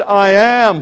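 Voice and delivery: "I am" rung out loud and full; booming declaration. Retold or imitated. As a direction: let "I am" ring like thunder. Repeating Jesus' answer.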